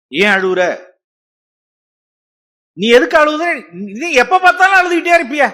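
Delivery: shrill, loud, rising exasperated nagging; the wrong way a husband scolds his weeping wife, loud and shrill; harsh scolding husband. Acted. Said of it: "இப்படி விசாரிக்கிறது இல்ல".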